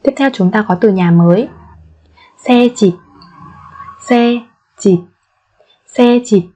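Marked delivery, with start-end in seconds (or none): 4.1-5.0 slow, separated syllables for learners; careful teacher's slow model. Shown as a demonstration. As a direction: spell it out slowly, beat by beat.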